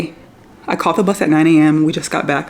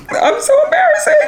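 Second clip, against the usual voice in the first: high embarrassed laugh; giggly embarrassed cry; laughing through tears. Real, not acted. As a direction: laugh it off while teary.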